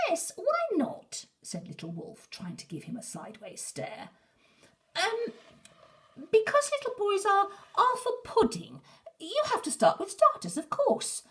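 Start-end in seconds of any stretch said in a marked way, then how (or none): 0.1-1.3 much higher, slow, trying to sound tough; a small wolf aping the big one; squeaky copycat. Acted. Little Wolf copying Big Wolf's question, high and slow. 4.9-11.2 much higher and louder, making it up; crafty boy. Acted. Herb tricking the wolves, high and bold.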